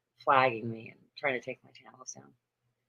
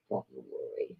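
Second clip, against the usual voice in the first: soft soothing murmur; 11 dB quieter than usual. Real, not acted. Soothing the cat.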